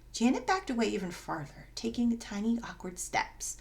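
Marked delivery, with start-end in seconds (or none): none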